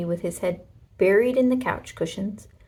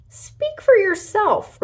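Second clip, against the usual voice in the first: much higher, louder, slow sassy reply; sassy retort; 13 semitones higher, 7 dB louder, slower than usual. A narrator's reading. Toy triceratops snaps back at Rex, high and sassy. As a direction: toss it back with sass. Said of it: "replied Trixie".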